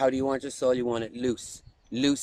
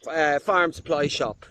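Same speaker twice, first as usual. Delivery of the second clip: ordinary delivery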